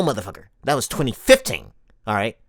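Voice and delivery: normal talking voice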